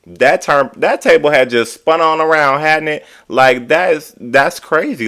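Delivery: high, disbelieving exclaim; incredulous. Real, not acted. Incredulous at reversed roles.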